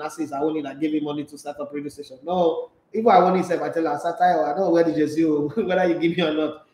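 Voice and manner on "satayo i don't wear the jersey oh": usual speaking voice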